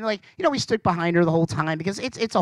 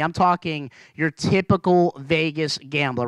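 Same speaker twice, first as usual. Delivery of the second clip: ordinary talk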